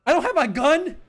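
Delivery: high loud shriek of panic; panicked shriek. Acted. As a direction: shriek as it sinks in. Officer shrieks realizing gun gone.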